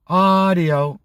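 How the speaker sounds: normal talking voice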